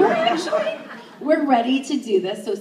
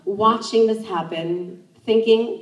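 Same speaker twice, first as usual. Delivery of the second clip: ordinary delivery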